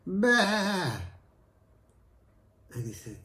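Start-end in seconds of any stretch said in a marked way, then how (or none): 0.1-1.0 higher, put-on bleat; goat bleat. Wife bleating back at angry husband. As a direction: answer only with a bleat. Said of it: "And she said".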